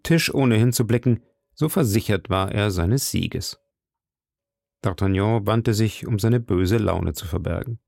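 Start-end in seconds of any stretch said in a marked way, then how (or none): none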